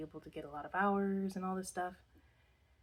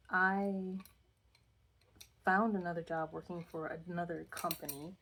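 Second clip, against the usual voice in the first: normal talking voice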